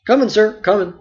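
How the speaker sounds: hurry-up reply from another room; Nob's eager reply. Acted. Nob answers from afar.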